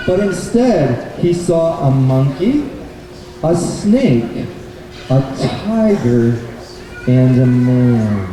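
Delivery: usual speaking voice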